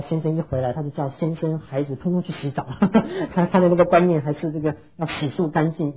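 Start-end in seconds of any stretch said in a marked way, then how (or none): 2.7-3.3 short high-pitched chuckle; amused laugh. Real, not acted. Preacher amused that the mother sends everyone to bathe, amused laugh. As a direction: laugh at your own anecdote.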